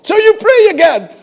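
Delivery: loud, high straining cry of effort; straining shout. Acted. Preacher acting out a desperate prayer, loud and straining. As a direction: strain and shout like lifting a weight.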